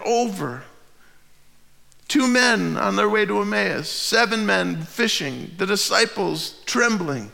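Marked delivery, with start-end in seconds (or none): none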